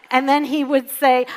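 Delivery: usual speaking voice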